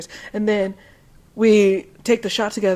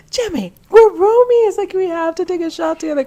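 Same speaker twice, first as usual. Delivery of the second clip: playful high-pitched begging; pleading peer pressure; 10 semitones higher, 3 dB louder than usual. Acted. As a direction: wheedle a shy roommate into joining. Pleads with roommate to join party.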